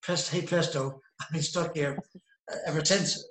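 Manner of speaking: normal talking voice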